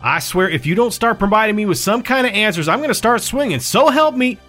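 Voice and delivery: high, rising angry threat; threatening. Acted. Mead threatening Palmetto with violence, heated.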